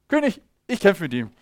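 put-on brave young David. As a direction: promise it proudly to the king. David offers to fight. (stolze, mutige Jungenstimme)

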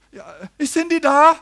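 put-on high, loud little boy's voice; small boy asking. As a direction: pipe up like a kid at the door.

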